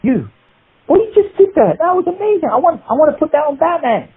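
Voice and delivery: high mock rave from the producer; excited producer. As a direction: gush like a producer who found gold. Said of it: "He goes".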